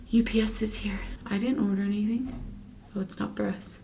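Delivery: hushed aside whisper. Real, not acted. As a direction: drop to a whisper. Delivery arrives during filming.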